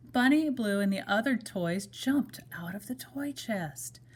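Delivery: ordinary delivery, nothing marked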